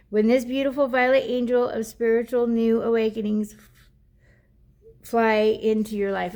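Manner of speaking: card reading to viewers; oracle card reading. Acted. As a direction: read the guidebook aloud like a gentle message. Reads the oracle card text.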